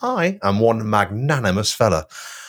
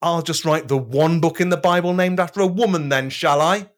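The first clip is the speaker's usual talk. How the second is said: sarcastic; 8 semitones higher than usual. Acted. Sarcastic retort to Jesus.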